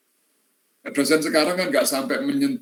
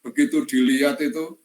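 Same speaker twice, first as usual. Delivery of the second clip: usual speaking voice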